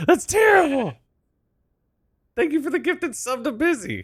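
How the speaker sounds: mock shriek of self-judgment; high squeaky voice. Streamer mocking his own bad accent in a squeal.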